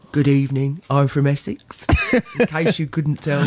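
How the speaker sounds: low, lightly put-on Essex accent; a hint of Essex, pitched low. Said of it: "Did you like my Essex accent then?".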